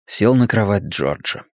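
ordinary delivery, nothing marked

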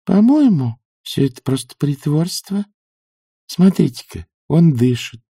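put-on high, matter-of-fact old woman; unperturbed. A narrator's reading. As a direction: point it out without any fluster. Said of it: "невозмутимо сказала она".

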